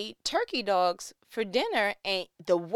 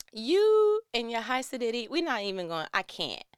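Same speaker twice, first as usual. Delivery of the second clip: usual speaking voice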